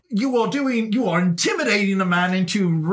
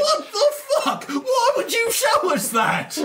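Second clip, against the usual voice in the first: much higher, crying out in real shock; shocked outburst. Real, not acted. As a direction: blurt it out horrified and upset. Player shocked by grim vision.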